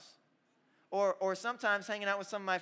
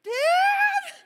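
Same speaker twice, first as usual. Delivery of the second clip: very high, loud falsetto scream; hammed-up squealing call; frantic mock shriek; 15 dB louder than usual. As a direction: imitate a panicked little brother shrieking. Imitates fooled brother calling out.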